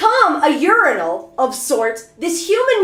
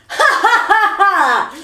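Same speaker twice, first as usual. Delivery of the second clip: imitated laughing; 4 semitones higher than usual. Conductor bursts out laughing at the passenger, hammed-up. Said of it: "he goes".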